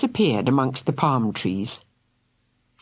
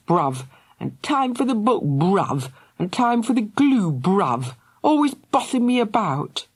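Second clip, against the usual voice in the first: raised, scornful scolding; angry rant; 7 semitones higher than usual. A narrator's reading. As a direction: pile on the insults at your sister. Angry brother rants.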